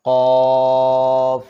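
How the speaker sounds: teacher demonstrating elongation; three-alif stretch. Shown as a demonstration. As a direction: hold কফ for a full three-alif count. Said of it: "তিন আলিফ পরিমাণ টান দিলে".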